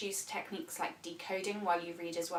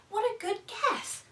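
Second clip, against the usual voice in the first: praise; 11 semitones higher, 5 dB louder than usual. Shown as a demonstration. Praising child's guess.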